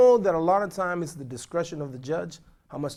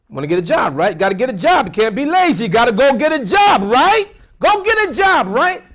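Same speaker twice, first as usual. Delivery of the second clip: rising higher and louder each time; climbing, louder call to the congregation; preaching build-up; 7 semitones higher, 11 dB louder than usual. Real, not acted. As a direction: drive it home like a preacher. Preacher builds a rhetorical point.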